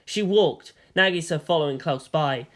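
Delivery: ordinary delivery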